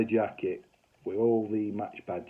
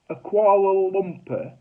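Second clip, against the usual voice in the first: slow, louder, higher incredulous repeat of "Kuala Lumpur"; loud disbelief; 11 semitones higher, 11 dB louder, slower than usual. Retold or imitated. Husband echoing wife's word, slow and loud in disbelief.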